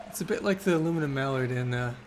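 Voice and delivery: ordinary talk, nothing marked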